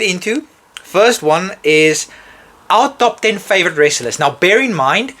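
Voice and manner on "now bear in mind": normal talking voice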